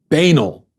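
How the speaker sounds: "Banal" as BAY-nul, falling; imitated mispronunciation. Host echoes the politician's mistake, saying BAY-nul. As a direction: repeat "Banal" with stress on a long BAY. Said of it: "what was that?".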